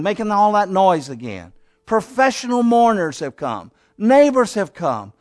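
ordinary talk, nothing marked

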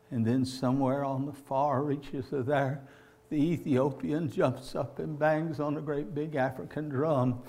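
ordinary talk

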